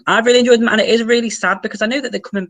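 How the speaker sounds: high, loud gush of feeling; heartfelt emphatic agreement. Real, not acted. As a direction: agree emphatically, pitch and volume leaping up. Echoes viewer's message with own feeling.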